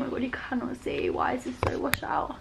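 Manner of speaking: unvoiced whispered aside, fast; real hushed whisper. Real, not acted. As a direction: whisper it to yourself.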